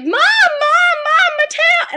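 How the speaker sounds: high, very loud scream for Mom; panicked scream. Retold or imitated. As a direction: shriek it like waking from a nightmare. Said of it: "s-, screamed".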